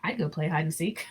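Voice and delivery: usual speaking voice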